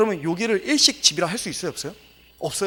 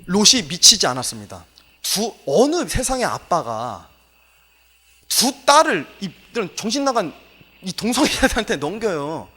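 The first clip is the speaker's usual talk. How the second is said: preaching at full force; 3 semitones higher, 6 dB louder than usual. Real, not acted. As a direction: hammer each phrase home to the congregation. Preacher raises voice to defend Lot.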